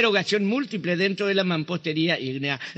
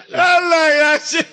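higher, loud cry still in laughter; excited exclamation; 7 semitones higher, 5 dB louder, slower than usual. Real, not acted. Reacting to the joke, excited cry. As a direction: shout it out, delighted.

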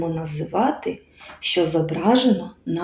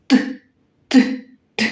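short, crisp "т" taps, spaced apart; hammer tapping sound; 5 semitones higher, faster than usual. Shown as a demonstration. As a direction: imitate a hammer with separate voiceless "т" clicks. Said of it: "Молоток стукає". (стукіт молотка: уривчасте «т, т, т»)

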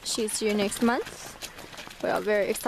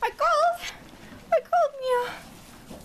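high apology; slower than usual. Real, not acted. Sorry after startling the mare.

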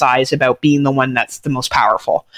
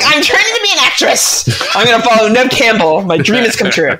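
aspiring actress; 4 semitones higher than usual. Retold or imitated. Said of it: "I was like".

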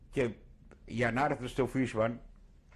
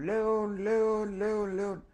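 calling out a name; 10 semitones higher, slower than usual. Retold or imitated. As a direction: shout the name up the stairs to find him. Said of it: "φωνάζαν". (φωνάζουν δυνατά και ψηλά το όνομα)

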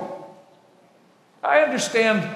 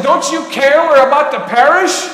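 loud, higher-pitched acted protest; alarmed plea; 5 semitones higher, 8 dB louder than usual. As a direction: cry out to wake the sleeper. Disciples wake Jesus in storm.

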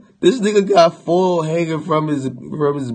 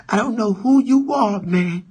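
a touch higher, hurt defensive whine; lightly voiced tearful rapper; 8 semitones higher than usual. Rocky talking back to the narrator, mild high whine. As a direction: sound wounded and defensive. Voicing Rocky's reply again.

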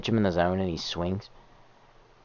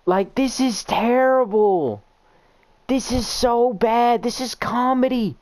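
whining despair; 13 semitones higher, slower than usual. Real, not acted. Despair at game.

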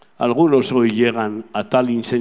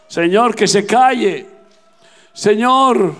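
high, raised preacher's voice; full-force preaching; 8 semitones higher, 5 dB louder than usual. Real, not acted. Preacher tells of the Canaanite woman following Jesus, impassioned proclamation.

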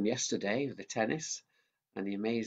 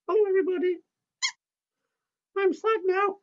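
much higher squeaky puppet; 8 dB louder, faster than usual. Acted. Mickey the puppet cutting in, high-pitched. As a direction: make the puppet pipe up.

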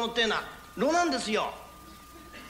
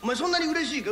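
teasing call; 6 semitones higher, 4 dB louder than usual. Acted. Asks tearful tenant.

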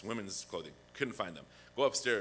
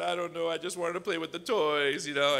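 put-on whiny child; 7 semitones higher, 7 dB louder than usual. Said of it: "I said".

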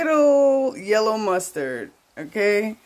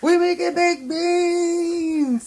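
much higher and louder, slow and stretched out; excited announcement; 11 semitones higher, 5 dB louder, much slower than usual. Real, not acted. Host announcing the dish to viewers, high and excited. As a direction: belt out the dish name like big news.

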